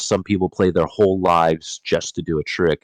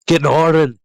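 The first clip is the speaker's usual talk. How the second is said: louder, higher mock-Scottish pronunciation; Scottish accent; 9 semitones higher, 6 dB louder than usual. Reader showing off a difficult name to co-hosts, playful Scottish accent. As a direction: punch out GNORDEN in a put-on Scottish accent. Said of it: "You put that accent on there".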